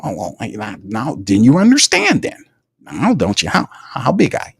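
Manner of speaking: a hint of a self-satisfied boss defending a favour; Mickey Loomis. Mocking the GM over a trade, lightly voiced smug executive. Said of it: "Mickey Loomis".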